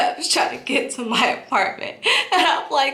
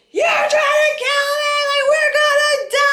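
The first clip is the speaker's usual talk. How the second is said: high, sing-song panicked holler; 3 dB louder than usual. Retold or imitated. Passenger to reckless driver, hollering in panic. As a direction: holler it high, stretching the words in mock terror. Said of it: "I'm literally hollering this".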